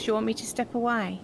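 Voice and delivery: ordinary delivery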